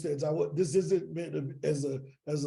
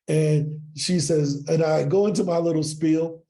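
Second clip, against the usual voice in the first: usual speaking voice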